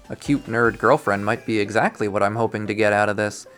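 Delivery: ordinary delivery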